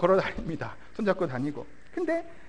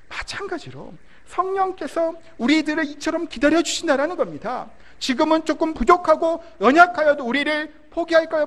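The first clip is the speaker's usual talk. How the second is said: sermon preaching; 5 semitones higher than usual. Acted. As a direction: preach it out to the congregation. Preaches to the congregation.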